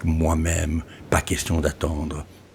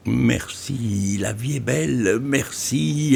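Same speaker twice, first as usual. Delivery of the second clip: plain reading voice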